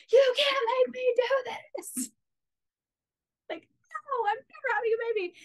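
high, strained cry to the heavens, breaking into breath; defiant outcry. Retold or imitated. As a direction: hurl the refusal upward at God. Defying God with fist raised.

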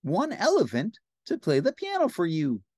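high, helpful cartoon pal; Ernie. Ernie presents an elephant, high cartoon voice. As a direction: announce it proudly in a high voice. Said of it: "said Ernie".

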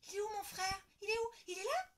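put-on high, loud searching child; girl shouting in the hospital hall; child's cry. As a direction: call out for the baby brother. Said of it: "crie Lola".

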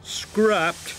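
high, incredulous scoff. A narrator's reading. Gordon echoes Diesel's threat in disbelief, scoffing. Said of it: "scoffed Gordon".

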